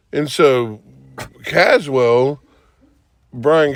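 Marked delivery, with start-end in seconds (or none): none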